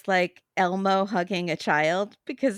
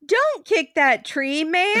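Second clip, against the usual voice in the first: higher, drawn-out mock scolding; Debbie; 7 semitones higher, 3 dB louder, slower than usual. Friend telling off a man for kicking a tree, mock scolding. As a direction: lightly play an indignant defender of the tree. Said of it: "Debbie would be like".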